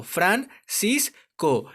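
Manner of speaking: very slow "Fran cis co", each syllable on its own. Shown as a demonstration. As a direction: say "Fran... cis... co" with pauses between. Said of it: "cómo se separan en sílabas".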